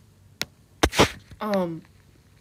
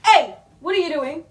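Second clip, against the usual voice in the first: sudden raised call, higher; calling to the dog; 6 semitones higher, 7 dB louder than usual. Real, not acted. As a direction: snap round at the dog mid-stream.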